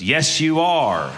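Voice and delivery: mock a grand lady who won't take no; firm teasing lady. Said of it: "And she goes".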